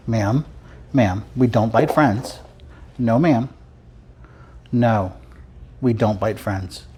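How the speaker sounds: slow, firm no to a nipping puppy; ma'am, no biting, said slow and stern; firm puppy scolding. Real, not acted. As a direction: tell the puppy off, patient but firm.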